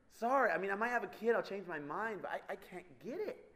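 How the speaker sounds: normal talking voice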